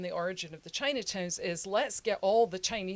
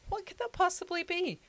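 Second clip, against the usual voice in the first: incredulous question; 12 semitones higher than usual. Retold or imitated. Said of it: "I'm like".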